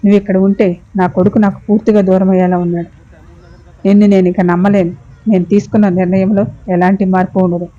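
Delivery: ordinary delivery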